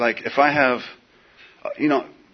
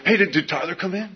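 higher, lightly voiced cop; mock cop; 8 semitones higher than usual. Cop asking about Tyler, higher mock voice. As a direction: ask it like a chummy cop.

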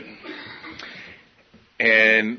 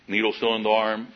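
ordinary delivery

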